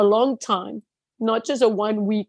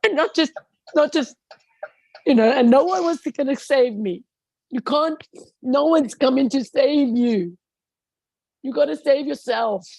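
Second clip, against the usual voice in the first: higher pitched, crying voice; pleading through tears; real crying, voice pushed up; 6 semitones higher than usual. Real, not acted. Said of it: "with tears".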